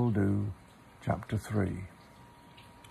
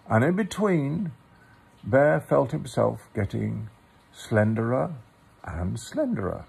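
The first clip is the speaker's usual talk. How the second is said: story narration; 5 semitones higher, 4 dB louder than usual. A narrator's reading. Reads a Pooh story aloud.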